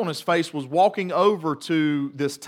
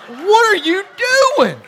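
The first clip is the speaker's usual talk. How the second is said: much higher and louder alarmed cry; shocked yell; 10 dB louder than usual. Retold or imitated. Father yelling at his son over the note, shocked shout. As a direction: shout it out in horror.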